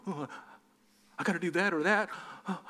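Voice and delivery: breathy, hammed-up dithering with gasps; imitated trembling indecision; mock quivering. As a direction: quiver helplessly over the choice, play-acting. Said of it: "stop quivering and start thinking".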